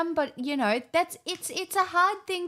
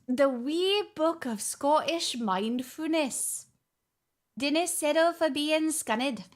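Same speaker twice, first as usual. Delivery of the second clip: put-on Scottish accent. Streamer reading a mindfulness book to viewers, Scottish accent. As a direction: read it out in a playful Scottish accent. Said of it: "I feel like my accent's gonna get really good".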